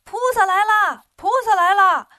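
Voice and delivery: high, loud yelling announcement; loud shout. A narrator's reading. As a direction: shout it across the courtyard. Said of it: "孙悟空跳到院子里大声喊". (大声高喊)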